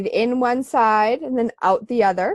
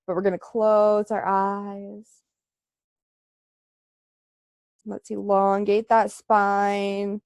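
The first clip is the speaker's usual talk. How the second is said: calm guided meditation voice. Acted. Leads breathing meditation.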